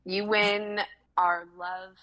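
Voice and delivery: normal talking voice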